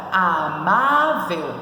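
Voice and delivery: Amável very slowly, a, má, vel; split into syllables. Shown as a demonstration. Teacher showing how to separate it, Amável in drawn-out syllables. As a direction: stretch out each syllable of Amável. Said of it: "Como que se separa?".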